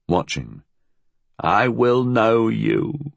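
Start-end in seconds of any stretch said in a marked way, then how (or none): 1.4-3.1 put-on slow, higher old-woman voice; slow and ominous, pitched up; soft menace. A narrator's reading. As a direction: draw it out as a quiet threat. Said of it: "Missus Sharper said softly".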